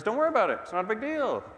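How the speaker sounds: high, mocking brush-off of "worry"; dismissive. Pastor mocking the crowd brushing off the dead girl, high and dismissive. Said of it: "See how they say it?".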